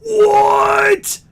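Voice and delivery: very high, loud yell of disbelief; real stunned shriek; shocked shout. Real, not acted. Stunned by a very rare card.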